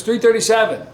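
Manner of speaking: high, loud call-out; acted yell. Newcomer trying a numbered joke on inmates, high yell. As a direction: shout it hopefully to the room. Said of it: "someone could just yell".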